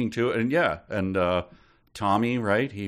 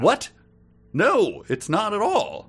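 high, incredulous objection; mock indignation; 9 semitones higher, 3 dB louder than usual. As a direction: refuse it in a raised, high pitch. Protesting the voice's unfair answer.

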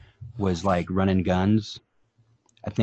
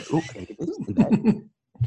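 high excited grabbing voice; giddy kid picking things up; greedy camper voice; 12 semitones higher than usual. Pretend. Mocking characters grabbing stuff.

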